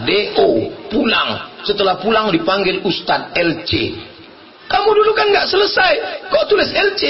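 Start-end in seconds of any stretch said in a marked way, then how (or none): 4.7-7.0 high, quick disbelieving question; incredulous challenge. Retold or imitated. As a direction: fire off a puzzled challenge, high and fast. Retold challenge to the returned graduate. (bertanya heran dengan nada tinggi)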